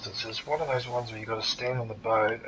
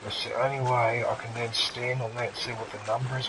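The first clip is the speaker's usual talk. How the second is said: ordinary delivery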